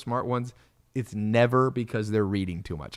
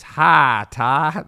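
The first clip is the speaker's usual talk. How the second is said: loud Southern country drawl; country-accented holler, pitched up; 3 semitones higher, 9 dB louder than usual. Retold or imitated. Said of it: "That's exactly how he sounded".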